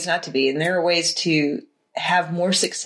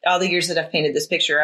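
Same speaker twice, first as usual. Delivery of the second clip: ordinary talk, nothing marked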